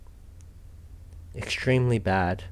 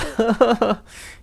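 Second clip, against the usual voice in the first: real laugh; 11 semitones higher, 6 dB louder than usual. Real, not acted. Streamer laughs at the character.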